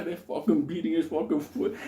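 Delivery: usual speaking voice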